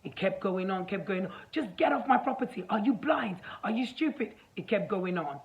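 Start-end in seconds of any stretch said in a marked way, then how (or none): 1.5-4.3 higher-pitched retold rant; mock angry screaming. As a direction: imitate a man hurling insults, pitch up. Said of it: "why do you keep screaming at me".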